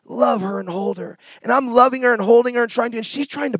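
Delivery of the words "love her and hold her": soft, low, tender repetition; tender divine voice. Retold or imitated. God telling the son to love his raging mother, soft and tender. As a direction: speak it gently like a calm command of love. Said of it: "God just said".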